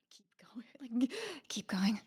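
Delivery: a sneaky hushed push to keep going; whisper. Acted. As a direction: egg them on under your breath. Secretly urging the party.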